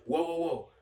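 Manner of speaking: mimicked bystander heading off a fight; imitated calming interjection. Bystander calming an angry driver.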